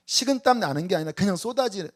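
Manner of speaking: usual speaking voice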